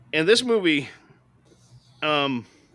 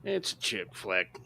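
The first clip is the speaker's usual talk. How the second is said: mock dismissive people; 8 semitones higher, 4 dB quieter than usual. Reviewer mimicking people who excuse chick flicks, mock dismissive. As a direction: imitate people brushing it off. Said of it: "people say".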